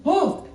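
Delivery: short, high, loud cry; startled yell. Acted. Kouki falling into a pitfall, startled yell. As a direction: cry out as the floor gives way.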